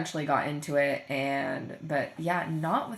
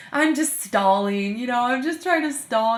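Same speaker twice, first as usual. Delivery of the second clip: student cheerfully admitting she stalls; laughing; 6 semitones higher, 8 dB louder than usual. Retold or imitated. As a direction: own up through laughter. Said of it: "Why are you laughing about that".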